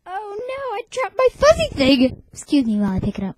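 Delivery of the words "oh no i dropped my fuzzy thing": high, rising alarmed cry; surprised. Real, not acted. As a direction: yelp it in sudden dismay. Sudden drop of toy.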